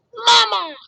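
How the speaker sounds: put-on very high, loud baby-bird squeak; tiny chick crying for its mama; gosling peep. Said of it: "peeped the gosling".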